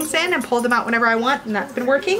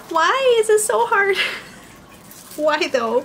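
exasperated squeal; 9 semitones higher, slower than usual. Real, not acted. Struggling with wrapper, exasperated.

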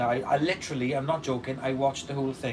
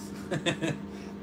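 breathy laugh; 2 semitones higher, 6 dB quieter than usual. Real, not acted.

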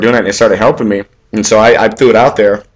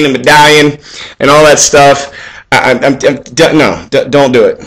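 usual speaking voice

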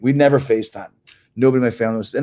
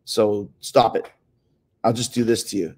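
ordinary talk

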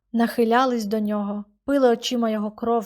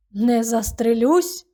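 hoarse cry; 4 dB louder, slower than usual. A narrator's reading. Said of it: "хрипко крикнув чоловік".

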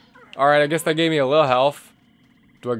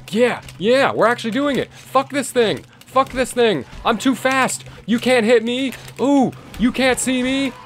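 high, gleeful taunts at the enemies; giddy high-pitched gamer taunting; excited taunting; 9 semitones higher than usual. Real, not acted. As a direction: crow it out, riding the rage. Frenzied blood rage fighting.

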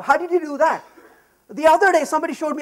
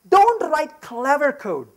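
slow, weighty rule spelled out; slow and emphatic; 2 semitones higher, slower than usual. Real, not acted. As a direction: lay down the rule slowly. Announcing a principle, slowly.

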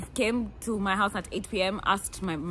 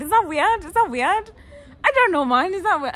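Is that weird? asked high and loud; high mock worry; 10 semitones higher, 9 dB louder than usual. Real, not acted. To the camera, worrying in a high loud squeal. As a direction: squeal the question at yourself. Teasing herself, voice shoots up.

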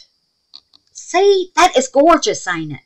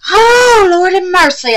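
delighted cry; 12 semitones higher, 11 dB louder, slower than usual. Real, not acted. Delight at the dragonfly ornament.

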